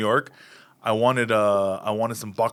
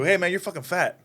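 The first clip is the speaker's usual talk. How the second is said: higher-pitched, straight-out jab with no retreat; fully committed delivery; 7 semitones higher than usual. Shown as a demonstration. Comedian showing how to sell a joke, fully committed. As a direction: say the insult like you believe it. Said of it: "It's about believing it".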